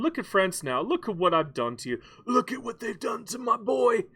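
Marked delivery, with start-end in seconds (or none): 2.3-4.0 high, put-on lament; mock anguish. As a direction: wail it like a hammed-up grieving parent. Mock lament over beaten France.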